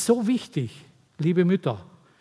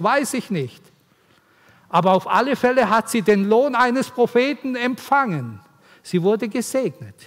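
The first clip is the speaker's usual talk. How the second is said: lifted, louder preaching voice, driving each point home; rousing preaching; 4 semitones higher, 4 dB louder than usual. Acted. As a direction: preach it to the mothers with fire. Preacher raises voice, emphatic. (eindringlich predigen, lauter und höher)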